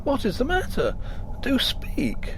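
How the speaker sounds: high, urgent pleading; anxious plea. A narrator's reading. Husband pressing his crying wife, anxious. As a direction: beg her to speak, worried.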